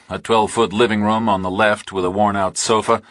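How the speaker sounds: usual speaking voice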